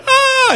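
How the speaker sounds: loud, very high imitated shriek; child's panicked scream. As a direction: mimic a frantic kid screaming. Son panics as sister goes outside.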